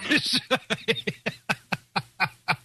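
long run of chuckles; laughing. Real, not acted. Host amused by his own skit, laughing. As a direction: laugh helplessly, trailing on.

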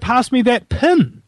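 "pin" in an Australian accent, the vowel of "pen" said like "pin"; mock Australian accent. Shown as a demonstration. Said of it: "the I will not be pronounced".

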